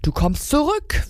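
high angry command, acted; raging cat. A narrator's reading. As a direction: order it back in a storybook rage. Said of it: "wütete die Katze".

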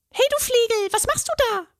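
very high, cross little fairy voice; a tiny fairy scolding a clumsy intruder; high, indignant elf. A narrator's reading. Fairy scolds the troll tugging her leg, high and cross. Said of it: "ertönte eine hohe, erboste Stimme".

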